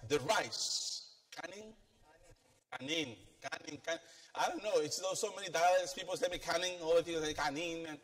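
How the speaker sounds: usual speaking voice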